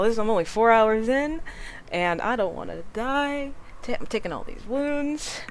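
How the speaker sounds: normal talking voice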